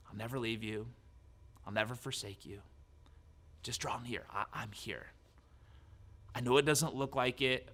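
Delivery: very soft, hushed reassurance; God's gentle whisper. Retold or imitated. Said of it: "God's there whispering".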